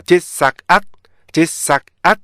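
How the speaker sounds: "디사앗" in separate punched syllables; stop-sound division. Shown as a demonstration. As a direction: chop "디사앗" into short stopped beats. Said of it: "이렇게 되죠".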